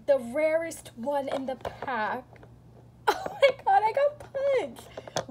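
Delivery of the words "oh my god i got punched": excited squeal. Real, not acted. Pulled the rarest one.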